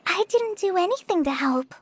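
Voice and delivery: ordinary talk, nothing marked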